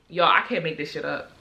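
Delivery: usual speaking voice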